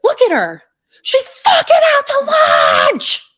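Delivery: retold yell, very high and loud; mocking screech. Onlooker to another woman about the screaming girl, screeched. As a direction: screech it, stretching the last words.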